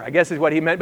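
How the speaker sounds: normal talking voice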